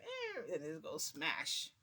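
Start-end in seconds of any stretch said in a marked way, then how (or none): 0.0-0.5 short high-pitched squeak; creaking trunk noise. Retold or imitated. Storyteller mimics the trunk being opened, high squeak. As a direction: imitate a trunk creaking open. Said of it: "it makes a".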